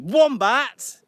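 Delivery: sudden loud, high burst on the name; punched, drawn-out shout; loud announcement. Shown as a demonstration. Loud model contrasted with whispered one.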